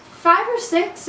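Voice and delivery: high, chirpy forced answer; fake cheerful. Girl answering a stranger while faking happiness, chirpy. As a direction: keep up the smiling act. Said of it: "put on the smile".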